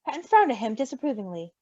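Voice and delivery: usual speaking voice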